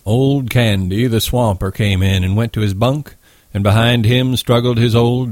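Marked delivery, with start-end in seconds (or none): none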